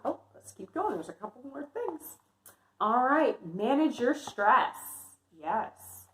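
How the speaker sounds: ordinary delivery, nothing marked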